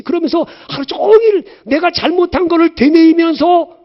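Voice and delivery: high, loud impassioned preaching; rising fervent sermon voice; preaching at full force. Acted. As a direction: cry it out from the pulpit.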